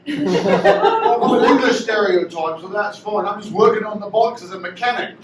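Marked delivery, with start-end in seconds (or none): 0.1-2.6 lightly Cockney English; Cockney accent. Acted. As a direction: play a Cockney bike mechanic. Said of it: "a bit of a Cockney accent".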